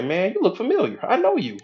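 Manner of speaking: high-pitched dawning recognition; recognizing critical mind. Acted. Critical mind recognizing a repeated idea, high pleased-to-know-you voice. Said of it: "the critical mind gonna say".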